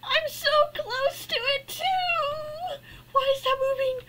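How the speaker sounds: high, tearful fear; real scared whine; frightened whine. Real, not acted. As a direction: whimper as it creeps closer. Still near the spider.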